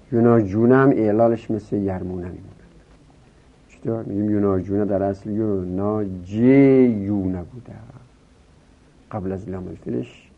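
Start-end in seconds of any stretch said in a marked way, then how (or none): none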